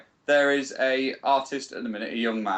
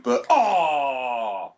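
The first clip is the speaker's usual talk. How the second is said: long, loud groan sliding downward; orgasmic groan; 2 semitones higher, 4 dB louder than usual. Real, not acted. Podcaster groans mid-sentence while playing FIFA, orgasmic groan. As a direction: let a sudden "oh" spill out like climax. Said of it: "You just come".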